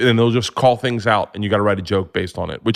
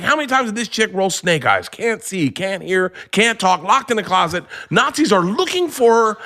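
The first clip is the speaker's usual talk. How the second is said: high-pitched incredulous rant; stunned disbelief; 10 semitones higher than usual. Retold or imitated. Comic retelling his shocked reaction to friends, high and incredulous. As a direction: pile it up like you can't believe it.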